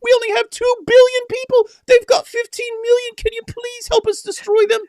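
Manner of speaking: very high, squeaky pleading voice; mocking falsetto plea; mock beggar. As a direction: beg in a ridiculous falsetto. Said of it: "like little beggars".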